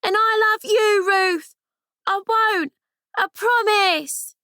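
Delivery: shouting back. Acted. Amy shouts back to Ruth from the gangplank, shouting. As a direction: throw it back across the gap with all her breath. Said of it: "she shouted as loud as she could".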